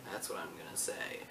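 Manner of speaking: whispered aside under his breath. Real, not acted. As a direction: drop to a quick whisper. Aside after a saying.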